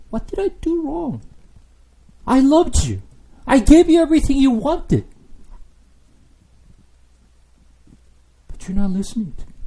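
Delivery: high, pained pleading; God asking hurt. Acted. God asking his people, high pained pleading. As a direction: ask what went wrong, hurt. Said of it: "God is just keep asking".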